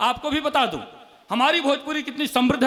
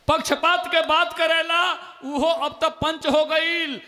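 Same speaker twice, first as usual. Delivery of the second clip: louder repeated poem line; 4 semitones higher than usual. Acted. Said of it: "दो मुक्तक और सुन लीजिए".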